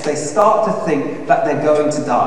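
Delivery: ordinary talk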